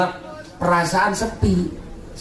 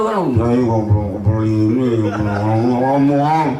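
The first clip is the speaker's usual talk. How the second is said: put-on low whining girl; 7 semitones lower than usual. Girl's whining reply acted.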